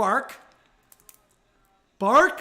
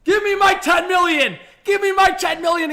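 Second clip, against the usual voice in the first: mock demanding shout; voicing Willie; 11 semitones higher, 9 dB louder than usual. Streamer voicing Nylander demanding his pay, loud high. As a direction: demand the money as the player. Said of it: "he says".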